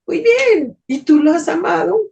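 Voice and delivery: a lightly voiced heavenly voice; beautiful voice. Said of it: "esta hermosa voz me dice". (voz celestial hermosa, aguda)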